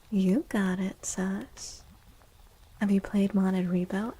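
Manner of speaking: usual speaking voice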